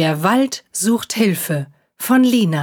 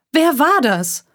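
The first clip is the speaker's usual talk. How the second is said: higher, indignant demand; upset cat demanding an answer; 8 semitones higher than usual. Acted. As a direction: ask sharply, annoyed. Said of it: "wollte sie aufgebracht wissen".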